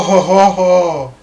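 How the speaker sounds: ordinary talk, nothing marked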